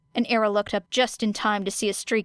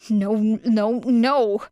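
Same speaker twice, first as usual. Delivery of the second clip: loud refusal; 5 dB louder, much slower than usual. A narrator's reading. Era refusing ambulance.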